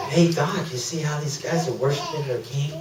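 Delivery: hushed, gentle questioning; soft Holy Spirit voice. Retold or imitated. Holy Spirit talking to the preacher, hushed. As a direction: drop to a soft, quiet prompt. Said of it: "then the Holy Spirit talks to me".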